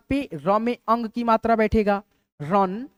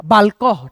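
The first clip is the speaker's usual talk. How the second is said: बालकह said louder, final ह clearly breathed; emphasized word; 6 dB louder, much slower than usual. Shown as a demonstration. Teacher loudly presenting the word for students to write. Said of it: "क्या".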